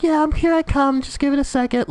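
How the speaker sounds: very high, silly squeaky voice; mock someone strolling up in a high falsetto; mock casual player. Streamer mimicking a casual approach in a game, high mocking voice. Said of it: "You're just like".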